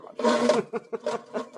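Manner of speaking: slow, high Elvis drawl; Elvis impression. Dog puppet doing Elvis after the joke, slow drawl. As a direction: thank the crowd like Elvis. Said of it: "Doug's impersonation of [name]".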